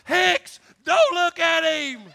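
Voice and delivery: loud, high, stretched-out holler; hollered warning. Retold or imitated. Recruit's cousin hollering from the back, loud warning. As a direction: yell it from the back of the room. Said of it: "cousin Dingus in the back hollered out".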